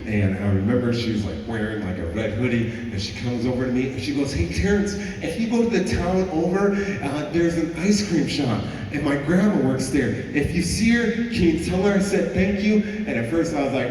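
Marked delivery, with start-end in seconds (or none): 4.3-12.8 put-on high, bright young girl asking a favour; girl's voice. Teller voicing the girl who asks him to thank grandma, pitched up high.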